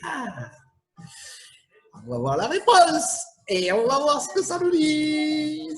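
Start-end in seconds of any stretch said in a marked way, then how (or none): none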